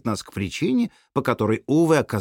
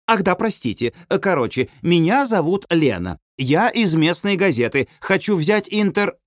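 high-pitched self-introduction; eager woman reporter; 6 semitones higher than usual. A narrator's reading. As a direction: introduce yourself brightly through a door. Журналистка торопливо представляется через ворота. (бойкая журналистка, высоким голосом)

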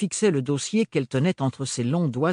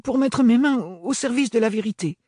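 higher, earnest answer, stress on mains; earnest young woman; 10 semitones higher, 4 dB louder than usual. Acted.

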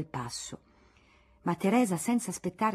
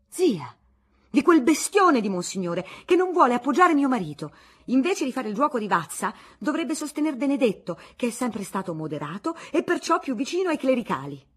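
indignant rant; 6 semitones higher, 7 dB louder than usual. Acted. Aunt ranting about her husband.

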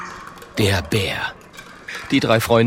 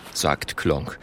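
usual speaking voice

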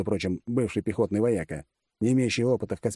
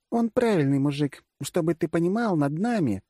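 higher-pitched voice of a blunt woman commander; female generalissimus; 9 semitones higher than usual. Acted. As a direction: explain it in the commander's high voice. (высокий голос женщины-генералиссимуса)

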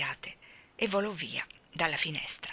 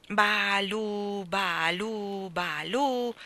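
put-on drawn-out singsong baby syllables; baby babble; 2 semitones higher, much slower than usual. A narrator's reading. Barbara babbling at the ceiling, baby voice. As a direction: croon nonsense like a baby. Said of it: "mormorò Barbara".

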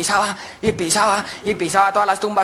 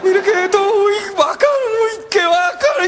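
aguda y lenta, encantado; emoción infantil; 12 semitones higher than usual. Acted. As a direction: celebra como niño feliz. Jorobado feliz sin joroba. (voz aguda de alegría)

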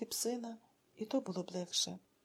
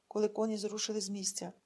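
plain reading voice

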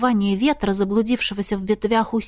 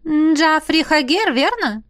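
higher, clipped impatient cut-in; impatient interruption; 7 semitones higher, slower than usual. A narrator's reading. Marlisa impatiently interrupts Jaf's warning. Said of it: "нетерпеливо перебила его Марлиза".

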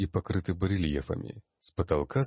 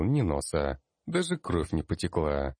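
ordinary delivery

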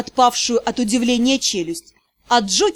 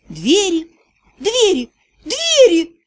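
rising tipsy excitement, building higher; excited; 4 semitones higher, slower than usual. A narrator's reading. Drunk heroine sees doors.

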